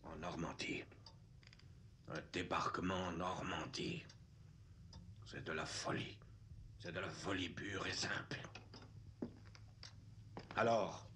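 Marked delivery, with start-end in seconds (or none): 0.0-8.4 low whispered disbelief; hushed whisper. Acted. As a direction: say it under your breath, much lower. Officer muttering in disbelief.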